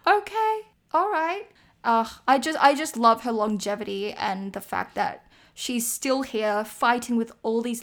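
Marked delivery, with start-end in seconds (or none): 0.1-1.5 a hint of surprised, pitched up high; light, wide-eyed okay-then reaction; mock impressed. Fan seeing quad toes on Instagram, mildly impressed high okay. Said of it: "I was just like".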